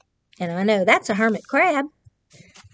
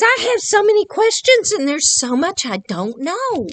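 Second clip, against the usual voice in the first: higher curious seagull; higher wondering seagull voice; 9 semitones higher, 4 dB louder than usual. Acted. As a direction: ask it like a wide-eyed bird. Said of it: "And this seagull says".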